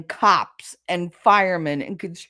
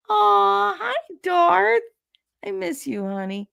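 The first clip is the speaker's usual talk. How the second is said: cooing at a pet; 14 semitones higher, slower than usual. Real, not acted. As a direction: coo sweetly at the pet dog. Greets her pet.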